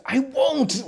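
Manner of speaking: high, quick mock outburst. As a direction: imitate a sudden blurt. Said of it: "that outburst".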